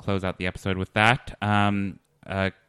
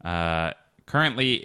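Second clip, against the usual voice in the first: ordinary talk, nothing marked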